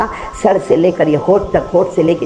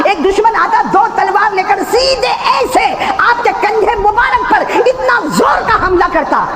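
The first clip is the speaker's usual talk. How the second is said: preaching at full force; 14 semitones higher than usual. Acted. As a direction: cry it out to the crowd.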